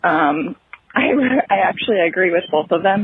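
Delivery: ordinary talk, nothing marked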